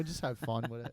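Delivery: normal talking voice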